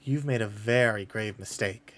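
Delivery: grave telepathic voice, low and quiet; voice in the head. Acted. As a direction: deliver a quiet, low threat. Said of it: "a voice just go".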